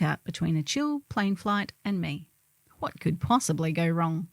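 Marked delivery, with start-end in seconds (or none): none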